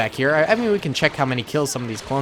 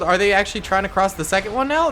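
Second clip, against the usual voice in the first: ordinary delivery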